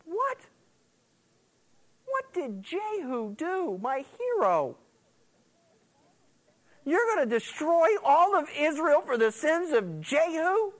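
high, pleading disbelief at the text; stunned disbelief. Retold or imitated. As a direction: protest in shock, voice shooting up. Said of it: "I go".

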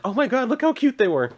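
high-pitched fangirl squeal of approval, hammed up; mock gushing. Mimics a gushing someone.

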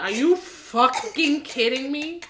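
high, slow mock indignation; Benedict. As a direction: drag it out in exasperated disbelief. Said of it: "Benedict was like".

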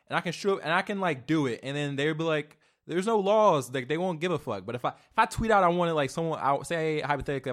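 loud, high, exasperated ranting; worked-up rant. Real, not acted. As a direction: rant at full volume, outraged by the unfairness. Worked-up rant about double standards.

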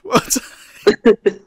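slow words through laughter; laughing. Real, not acted. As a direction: laugh it out slowly. Laughing over the memory.

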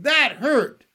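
high, loud, pained protest; punchy high cry; screamed complaint. A narrator's reading. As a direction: yell it in outraged pain. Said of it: "he screamed".

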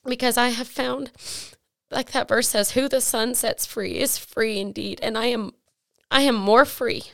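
high voice full of real tears; emotional, tearful. Real, not acted. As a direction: testify while holding back crying. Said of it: "I'm getting emotional. I hate crying".

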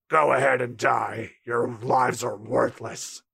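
low, slow, soft menace; low slow villain voice; low villain threat. Acted. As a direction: condemn them coldly. Voicing Arlong's threat.

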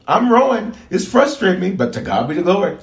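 acted joy despite frustration; joyful rowing. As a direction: rejoice through the hard work. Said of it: "until they started to be joyful".

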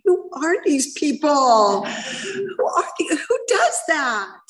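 high, slow, tearful disbelief; choking up as the kindness hits; voice breaking. Real, not acted. Recovering alcoholic recalling a stranger's kindness, voice cracking with tears.

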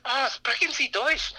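high, slow, delighted German greeting; excited German lady. Retold or imitated. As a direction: voice the lady lighting up at hearing German.

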